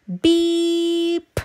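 high, bright toy horn beep; little truck beep. A narrator's reading. Friendly little truck beeping to the farm animals. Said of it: "said Blue".

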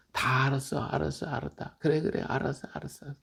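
soft, fading reassurance; God soothing. Retold or imitated. God comforting the weeping believer, softly reassuring. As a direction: hush a crying child gently. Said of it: "그러는 거죠".

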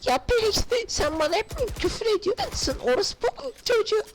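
high, teasing put-on voice; high mocking voice. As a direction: taunt the teammate in a squeaky voice. Taunts in high voice.

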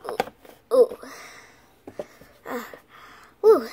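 ordinary delivery

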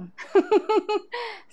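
high, bubbly giggle; amused laugh. Real, not acted. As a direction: burst into a short run of high giggles. Chuckles at own fish comparison.

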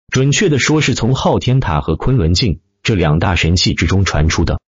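plain reading voice

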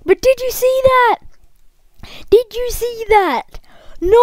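high, loud incredulous demand; screaming. Real, not acted. Player to viewers about the glitch, incredulous scream. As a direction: cry out, pitch way up, demanding a witness. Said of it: "sorry for screaming into the mic".

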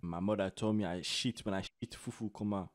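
West African accent, played for laughs; put-on West African accent. Streamer asking a stranger if he's from Ghana, put-on West African accent. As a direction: question them in a mock West African accent.

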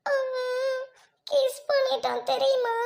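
slow, tearful reveal; sob, then let it out slowly; sobbing confession. Acted. Girl revealing the kiss to friends, sobbing.

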